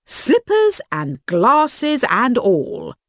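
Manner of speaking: plain reading voice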